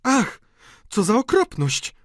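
high, loud horrified lady; shocked high female voice; appalled exclamation. A narrator's reading. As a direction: cry out in dismay at the sight. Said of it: "zawołała".

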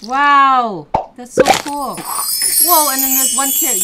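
loud, high wow of delight; big impressed exclamation; excited amazement. Real, not acted. Parent admires child's garden.

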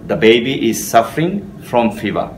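slow dictation of an English example. Shown as a demonstration. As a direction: read it out very slowly, word by word. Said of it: "model sentence read slowly".

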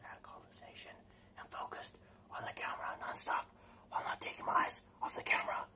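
hushed, unbroken stage whisper; intense whisper. Shown as a demonstration. Vlogger mimicking nonstop focus on the camera, intense whisper. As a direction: whisper it with fixed concentration.

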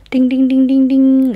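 loud, high ding-ding like a quiz-show bell; playful winner's bell, loud and high; mock correct-answer bell. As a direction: ring it out like a game show chime. Game-show bell for a correct guess.

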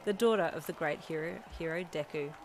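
ordinary delivery, nothing marked

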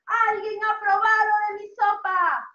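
loud, chanting storybook bear growing indignant; annoyed Papa Bear. Acted. Teacher voices papá oso finding his soup tasted, loud and annoyed. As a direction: boom it out in mock outrage.

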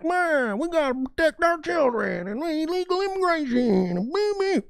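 very high, whiny caricature; mock the crowd in a squealing cartoon voice; drawn-out falsetto political griping; mock right-wing ranter. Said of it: "everybody's like".

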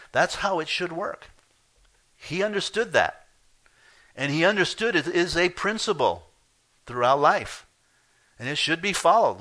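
loud, high emphatic preaching; hammering the word authority; full-force preaching. Real, not acted. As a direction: drive the point home.

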